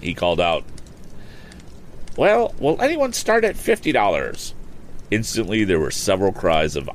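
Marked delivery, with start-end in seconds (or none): 2.2-4.5 impatient. Acted. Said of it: "as if impatient".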